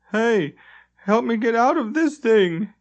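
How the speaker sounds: trapped and calling out, high and urgent; yelled. A narrator's reading. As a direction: yell from inside the stuck bubble. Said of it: "yelled Limbeck".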